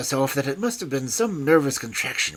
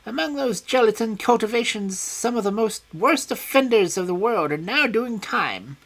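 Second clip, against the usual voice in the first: high, loud self-satisfied voice; high vain scientist; high smug boast; 8 semitones higher, 5 dB louder than usual. A narrator's reading. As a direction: show off your prisons. Smith boasting of his cultures.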